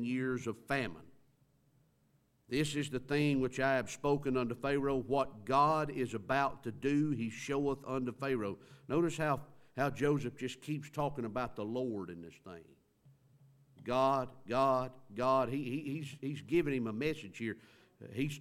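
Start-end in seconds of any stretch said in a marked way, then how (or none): 2.5-8.6 raised, solemn reading of the verse; a preacher's scripture voice. Acted. Reads scripture aloud. 13.8-15.5 each God hit louder than the last; preacher pounding on God; emphatic repetition. Real, not acted. Preacher hammering the name.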